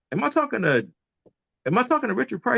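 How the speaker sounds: high, amazed imitation of a proper-speaking lawyer; imitating the lawyer. Lawyer on the conference call, hearing the comedian, in a high amazed voice.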